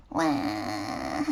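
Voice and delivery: low, drawn-out mock baby cry; baby's wail. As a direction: imitate a newborn's long wail. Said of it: "my little sister was saying".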